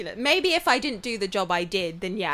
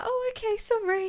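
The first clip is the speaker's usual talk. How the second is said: high apologetic voice; 12 semitones higher than usual. Retold or imitated. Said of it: "I was like".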